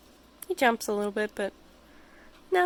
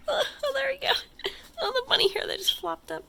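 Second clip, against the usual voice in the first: high, gushing over a cute bunny; cooing over the rabbit; delighted squeal; faster than usual. Real, not acted. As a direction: pitch jumps up in delight.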